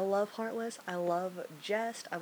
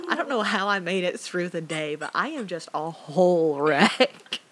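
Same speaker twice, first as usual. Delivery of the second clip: ordinary talk, nothing marked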